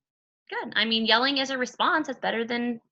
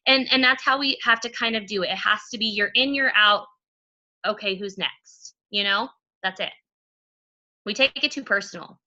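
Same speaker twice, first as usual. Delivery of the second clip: usual speaking voice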